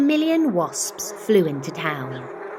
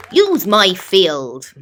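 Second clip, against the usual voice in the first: slow, much louder farmer's offer; 8 dB louder, slower than usual. A narrator's reading. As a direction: plant it firmly, like a proud farmer. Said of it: "Farmer Seed said".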